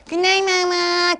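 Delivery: high, stretched goodnight; high storybook child voice; little monkey. Shown as a demonstration. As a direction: sing it out high as a small monkey. Little monkey puppet, high again.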